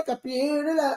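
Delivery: a hint of that man with the tube; mock talk-box voice. Naming the old song in a light talk-box voice. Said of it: "Y'all remember that man".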